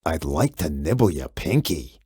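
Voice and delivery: very low, rumbling monster voice; deep monster Gabe. A narrator's reading. Gabe the monster greets the kid, very low and rumbling. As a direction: speak from deep under the bed like a friendly beast.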